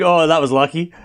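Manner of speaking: ordinary delivery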